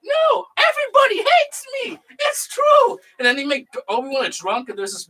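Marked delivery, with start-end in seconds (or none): 0.0-3.0 high, whiny mock sob in a goofy voice; Jar Jar voice. Jar Jar admitting everyone hates him, high mock whine. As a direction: whimper it like a sad cartoon creature.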